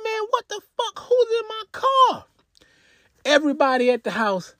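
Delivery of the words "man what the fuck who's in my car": imitating friends, pitched way up in a put-on voice; mock outrage. Friends watching the AirTag, mock outraged high voice. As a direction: do the stunned friends high and strained. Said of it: "They're like".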